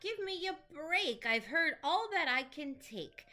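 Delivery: high, loud, exasperated roar; lion roaring back. Acted. Lion loses patience with Monkey, loud and exasperated. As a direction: bellow at the monkey like a fed-up lion. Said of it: "Lion roared back".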